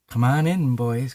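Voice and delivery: high, loud seductive invitation; flirty come-on. Retold or imitated. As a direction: beckon the men in, flirting. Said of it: "'cause she was a harlot".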